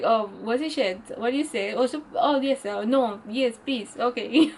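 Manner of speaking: mock your own panic when put on the spot; flustered fumbling self. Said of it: "I'm like".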